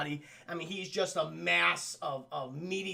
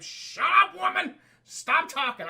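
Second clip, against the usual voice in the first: high, loud angry snap; exasperated yell; 10 semitones higher, 8 dB louder than usual. Retold or imitated. Husband yelling at his nagging wife by the broken car. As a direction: shout her down.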